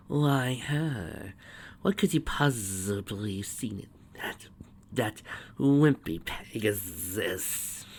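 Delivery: slow, whispery disdain; drawn-out, contemptuous villain voice; scornful villainess. A narrator's reading. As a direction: sneer it slowly, half-whispered. Villain glares, scorns the rival.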